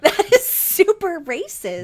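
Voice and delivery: laughing through the words; laughing protest. Real, not acted.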